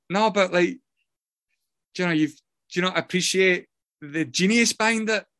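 hammed-up hurt artist pleading his case; mock-wounded protest. Husband defending his song to his wife, mock-wounded.

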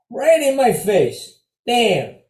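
hammed-up indignant yell; mock outrage. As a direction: relive the disgust with a loud groan. Pigeon hit his face, outburst.

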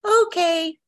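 a small squeaky stranger's voice blurts out okay; another voice. Retold or imitated. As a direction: pops out high and loud, as if someone else answered. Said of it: "some other alternative voice said".

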